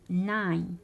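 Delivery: loud ناين with a gliding to i; ناين like English "nine"; ai diphthong. Shown as a demonstration. Teacher models German "no" for learners, ناين with clear ai. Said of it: "يتنطق تاني اي".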